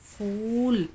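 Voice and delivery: फूल में ऊ को खूब खींचकर; लंबा खिंचा ऊ. Shown as a demonstration. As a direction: draw out the long 'oo' in फूल. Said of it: "खूब खींच कर पढ़ा जाता है". (फूल का ऊ लंबा खींचें)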